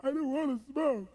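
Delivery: high mock-tearful protest; hammed-up crying complaint; freaked-out whine. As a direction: wail it like a broken smoker. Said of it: "he's freaking out of his mind".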